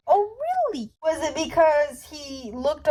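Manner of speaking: feigned astonishment; an exaggerated, high sarcastic gasp of surprise; mock surprise. Sarcastic reaction to news.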